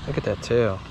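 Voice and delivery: ordinary delivery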